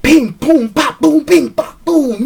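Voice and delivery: rapid-fire vocal impact effects; imitated fight noises. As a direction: pop out each blow like a comic fight. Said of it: "it was like".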